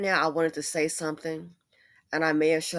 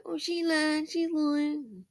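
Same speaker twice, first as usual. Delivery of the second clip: high, sassy mocking voice, much higher; 10 semitones higher, much slower than usual. Mocking a doubter in a high sassy voice.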